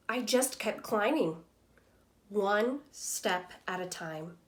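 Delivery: lively storybook reading voice for a class; reading to children. Acted. Teacher reading Humpty Dumpty's story to students, lively storytelling voice. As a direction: read it like a picture book to young kids. Teacher reads picture book aloud.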